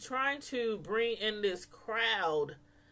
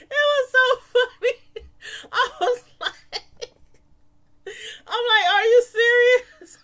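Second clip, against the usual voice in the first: loud, high words squeezed through real laughter; helpless laughter; 12 semitones higher, 9 dB louder than usual. Real, not acted. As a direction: talk while cracking up completely. Overcome with laughter while retelling.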